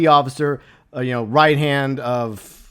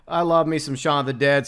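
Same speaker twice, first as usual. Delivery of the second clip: normal talking voice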